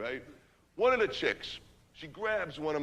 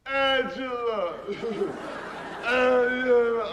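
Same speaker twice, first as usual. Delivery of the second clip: long drawn-out yell, higher and louder; lovesick yell; 6 semitones higher, 3 dB louder, much slower than usual. Retold or imitated. Lovesick friend yelling for Angela from the car, long drawn yell. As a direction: howl her name out the car door. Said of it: "He's going".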